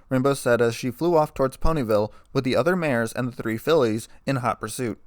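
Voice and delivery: plain reading voice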